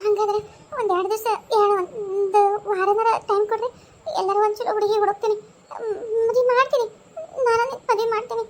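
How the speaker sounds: tearful, breaking voice; wife weeping as she gives her husband away; crying. Acted. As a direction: force the words out through tears. Wife promises husband's remarriage tearfully.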